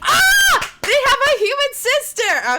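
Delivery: high, loud shriek; shocked delight. Real, not acted. Viewer learning of the human sister, high shocked shriek. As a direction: shriek it out at the reveal. Reacting to the twist.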